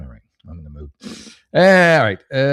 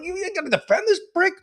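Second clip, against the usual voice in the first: very high, strained disbelief; retold outraged squeal. To the manager defending the clerk, a high-pitched squeal. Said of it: "I'm like".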